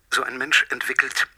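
ordinary talk, nothing marked

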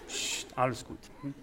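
hushing softly; calm a frightened child in a whisper; soothing whisper. Pretend. Comforting crying children.

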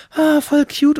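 high, loud squeal of delight; swooning high squeal over something cute; gushing excitedly. Retold or imitated. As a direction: gush like a smitten teen. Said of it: "sind wir an dem Abend so".

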